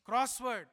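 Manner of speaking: Crossword said loud and high; stressed word. Shown as a demonstration. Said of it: "Crossword to Ephraimites".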